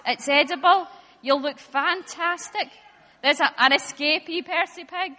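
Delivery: normal talking voice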